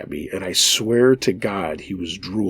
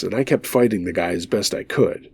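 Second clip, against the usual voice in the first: plain reading voice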